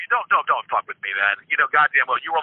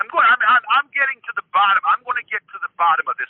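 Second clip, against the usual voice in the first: heated insistence; 8 semitones higher than usual. Real, not acted. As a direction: dig in, worked up and rushing. [name] insisting angrily in argument.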